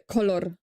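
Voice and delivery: color with a hard rolled R at the end; low color, Polish hard R. Shown as a demonstration. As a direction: say color with a trilled final R. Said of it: "I'm not saying".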